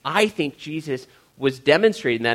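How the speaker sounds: ordinary delivery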